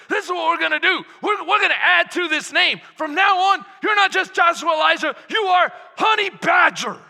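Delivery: dad crowning his son Honey Badger, loud; proud shout. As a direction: announce the new name like a battle cry. Dad renaming son, fired up.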